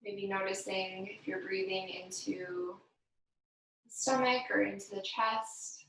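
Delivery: unhurried, gentle breathing guidance; guided meditation. Acted. Yoga instructor guiding the class through breathing, calm meditation voice. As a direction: lead the class calmly through each breath.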